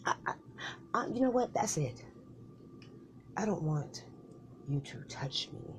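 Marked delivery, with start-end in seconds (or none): 3.4-5.7 sulky whisper. Acted. Sulking in argument.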